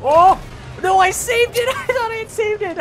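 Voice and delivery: high, loud excited yelp; excited shout. Real, not acted. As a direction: cry out in high panic then laugh. Nearly falls in game.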